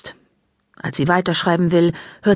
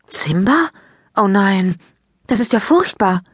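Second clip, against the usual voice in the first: shocked exclamation; 3 semitones higher than usual. A narrator's reading. Maike hears her friend's rabbit died, shocked outcry. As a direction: gasp it out in shock. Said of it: "ruft Maike".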